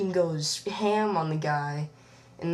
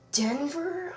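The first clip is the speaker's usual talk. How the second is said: unsure question; 10 semitones higher than usual. Real, not acted. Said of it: "I'm not too sure".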